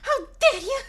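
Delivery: high loud anime outrage; overacted anime dub. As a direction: shriek it like an anime girl. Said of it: "very deranged VTuber".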